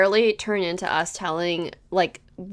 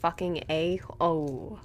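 embarrassed mutter; 6 semitones lower, 13 dB quieter than usual. Real, not acted. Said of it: "that was embarrassing".

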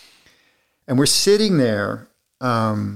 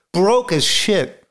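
choked up; 6 semitones higher, slower than usual. Real, not acted. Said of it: "I get emotional".

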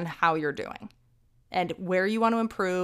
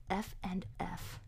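whispered aside; 11 dB quieter, slower than usual. Real, not acted. Aside while writing.

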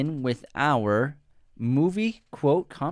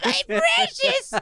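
much higher, slow, drawn-out Gollum voice; Gollum impression; 3 dB louder, much slower than usual. Mocking the co-host's failed accent as Gollum. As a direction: stretch out a very high Gollum hiss. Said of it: "That's Gollum".

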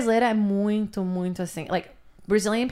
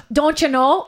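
put-on Minnesota accent; 5 semitones higher, 6 dB louder than usual. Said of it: "That's the Minnesota thing".

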